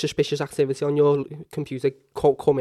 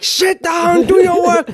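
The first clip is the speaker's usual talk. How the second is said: high, loud teacher shout; mock-yell like a furious teacher; shrill classroom yell, retold; imitating a scream; 13 semitones higher, 6 dB louder, much slower than usual. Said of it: "Miss Obanez just screaming at him".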